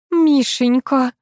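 lightly voiced fussing mother; clucking mother. A narrator's reading. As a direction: cluck over the child, slowly. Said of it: "кудахтала мама".

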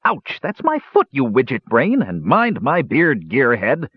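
squeals high on the ouch, then grumbles on; a squashed dwarf swearing at his companions; dwarf cursing his way out of a booth; grumbling dwarf. A narrator's reading. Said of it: "with much groaning and swearing".

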